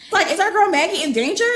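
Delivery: high, loud and fast worried question; breathless fan panic; alarmed excitement. Real, not acted. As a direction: rush it out in suspense. Worried reader reacting to plot.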